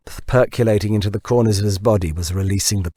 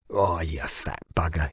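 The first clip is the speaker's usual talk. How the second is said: soft wheezy strain under a load; mildly wheezed, lifting heavy weight; a touch of wheeze, quieter; wheezing; 4 semitones lower, 7 dB quieter than usual. A narrator's reading. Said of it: "he wheezed".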